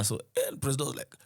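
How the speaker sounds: normal talking voice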